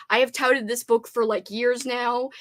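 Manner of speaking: normal talking voice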